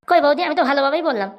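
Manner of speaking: ordinary talk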